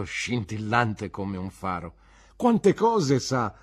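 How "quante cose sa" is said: slow, higher, louder; admiring praise. Acted. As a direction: marvel at her cleverness. Ham praises Emily. (lodi ammirate, lente e più alte)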